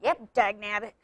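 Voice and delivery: gruff grizzled gold miner voice; lightly voiced old prospector. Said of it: "your rather poor attempt to talk like a grizzled old prospector".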